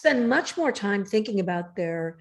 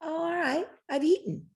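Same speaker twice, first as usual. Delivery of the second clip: body's voice; 10 semitones higher, 4 dB quieter than usual. Pretend. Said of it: "your body goes".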